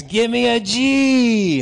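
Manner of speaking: loud, high, drawn-out cheer call; cheerleader yell. Retold or imitated. Former mascot rallies Georgia fans, big cheer shout. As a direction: lead the cheer with a long shout. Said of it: "everybody's yelling out these different Georgia cheers".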